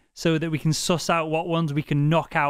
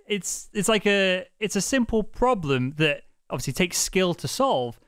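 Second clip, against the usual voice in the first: usual speaking voice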